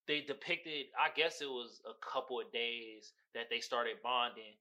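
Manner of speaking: ordinary talk, nothing marked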